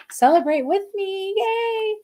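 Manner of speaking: high, bright birthday cheer; a sudden high party whoop; excited cheer. Real, not acted. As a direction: cheer them on to celebrate.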